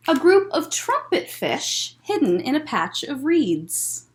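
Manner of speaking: gentle picture-book storytelling voice; reading to children. A narrator's reading. Librarian reads Mister Seahorse aloud to children, gentle storytelling.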